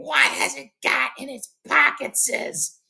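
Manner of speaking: high, slow creature shriek; Gollum shrieking. Acted. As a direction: shriek it like a wretched cave creature. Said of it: "Gollum starts shrieking".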